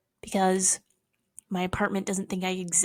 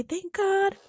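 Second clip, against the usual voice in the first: high quip; 11 semitones higher than usual. Pretend. Quip after hymn.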